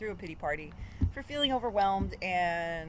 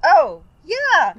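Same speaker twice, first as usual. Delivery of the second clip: 민망하게 큰 소리로 대답; sheepish loud yes; 8 semitones higher, 12 dB louder than usual. Retold or imitated. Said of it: "I was like".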